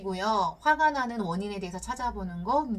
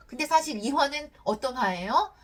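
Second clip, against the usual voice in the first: ordinary talk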